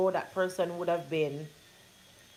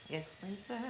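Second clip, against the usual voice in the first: soft cooing; 3 semitones higher, 11 dB quieter than usual. Real, not acted. Talking to baby daughter.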